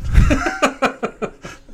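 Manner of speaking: high breathy burst of laughter; sudden high laugh. Real, not acted. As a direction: break up laughing at yourself. Laughing at own readout.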